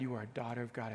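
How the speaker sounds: usual speaking voice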